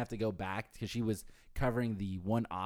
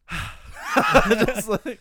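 sigh into laugh; 12 semitones higher, 13 dB louder than usual. Real, not acted.

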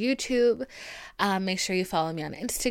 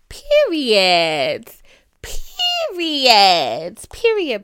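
loud, emphatic declaration, rising high; emphatic shout; 4 semitones higher, 10 dB louder, much slower than usual. Acted. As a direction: belt out each word for emphasis. Hyping herself after affirmations.

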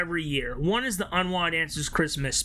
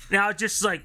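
usual speaking voice

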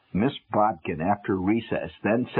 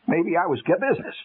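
plain reading voice

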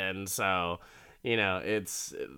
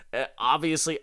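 ordinary delivery, nothing marked

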